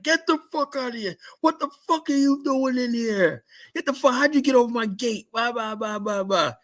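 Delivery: high, strained yell at a stranger; alarmed yell. Retold or imitated. Guard yelling at a figure in the depot, high alarmed yell. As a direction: yell like you've found a trespasser.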